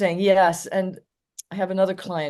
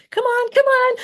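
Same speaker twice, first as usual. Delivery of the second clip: very high, louder excited call; hyped calling; 7 dB louder than usual. Retold or imitated. The owner calling his dog back, high and excited. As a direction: rev the dog up with a squealing call. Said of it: "but then he says".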